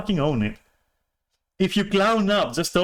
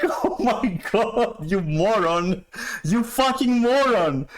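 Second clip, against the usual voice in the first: exasperated; 7 semitones higher, slower than usual. Retold or imitated. Exasperated retold reaction.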